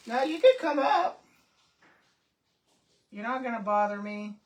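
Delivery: loud call to another room; yelling across the room. Real, not acted. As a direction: shout it up from upstairs. Calling to someone downstairs.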